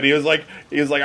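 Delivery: ordinary talk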